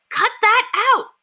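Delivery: high, loud, slow yell; a boy yelling as water sprays everywhere. Acted. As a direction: shout it to stop the mess. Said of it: "yelled Henry".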